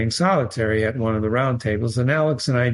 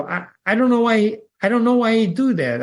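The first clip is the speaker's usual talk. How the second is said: higher and louder, stammering and flustered; flustered apology; 11 semitones higher, 3 dB louder than usual. Retold or imitated. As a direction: blurt an embarrassed apology. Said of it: "I said".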